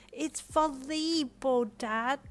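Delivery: high, drawn-out correction with an eye roll; sassy little girl. A narrator's reading. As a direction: correct dad like a fed-up kid. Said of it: "Ko sassed with an eye roll".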